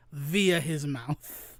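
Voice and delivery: breathy whisper, trailing off; whispered punchline. Real, not acted. Punchline dropped low.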